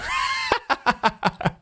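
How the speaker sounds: high-pitched staccato laugh; squeaky, rattling laugh. Real, not acted. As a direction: laugh in rapid, very high bursts. Laughs as shaders fail.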